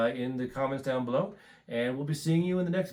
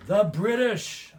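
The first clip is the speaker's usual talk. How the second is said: dramatic reveal; 8 semitones higher, 6 dB louder, slower than usual. Real, not acted.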